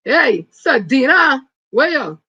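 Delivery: loud slow shout-out; calling out. Real, not acted. Greeting a viewer by name on the live, loud call.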